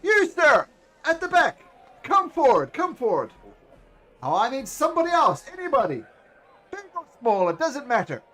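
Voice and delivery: booming high showman's welcome, then slowing down; grand ringmaster. Acted. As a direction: announce it like a circus ringmaster to the crowd. Ringmaster calls volunteers up.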